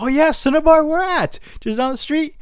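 eager tourists. Retold or imitated. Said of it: "'cause they were excited".

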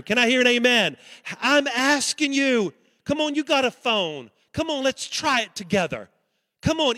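fervent preaching call. Real, not acted. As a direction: push the crowd, rising fervor. Pastor exhorting congregation.

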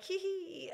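high little imitated hee hee giggle; mock giggle. Explaining tickled pink to her friend, a high mock giggle. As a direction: titter like someone tickled. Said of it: "somebody's like".

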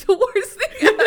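laughing through the words. Real, not acted. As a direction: laugh it out, high and loud. Laughing about hating it.

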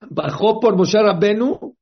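fuerte y más agudo, decidido; lánzalo como un guerrero que sale a cazar. Acted. Satán sale a buscarlo. (el Satán armado sale decidido a buscar a Moshe, fuerte)

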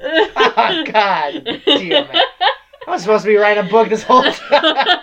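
hammed-up author's panic through laughter; laughing mock dismay. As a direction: curse in mock realization while cracking up. Acting author realizing mistake, laughing.

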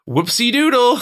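high, flippant "oops"; mock careless. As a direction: toss it off like a careless author. Mocking Bradbury's careless writing.

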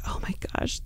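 hushed, breathy-free whisper of embarrassment; embarrassed whisper. Retold or imitated. Mom whispering to crying daughter, mortified. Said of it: "I'm like".

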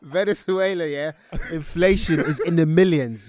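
very loud, high, emphatic riffing over laughter; loud joking exclamation. Real, not acted. Co-host ribbing friend about Venezuela, loud and emphatic. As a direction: shout the punchline to land it.

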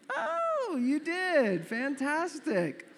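very high, drawn-out "oh", slow finish; overdone mock-impressed reaction; feigned delight. Suitor hearing his date made a husband list, feigned delight.

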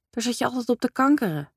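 reproachful. Acted. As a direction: needle him, pitch up on "kankeren". Mother reproaches father.

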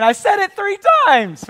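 put-on a fed-up mother snapping at her kid; exasperated mom. Mom returning to find the mess, loud exasperated scolding. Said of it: "your mother coming back and saying".

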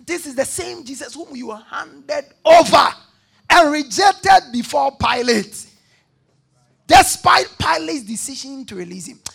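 loud preaching shout, pressing each phrase; fiery preaching. Acted. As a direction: drive it home to the church. Preaching at full force.

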